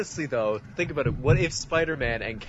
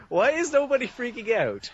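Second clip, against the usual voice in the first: higher-pitched exasperated question; incredulous, pitched up high; mock exasperation; 9 semitones higher than usual. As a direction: ask it in mock disbelief. Acting out disbelief at the scene.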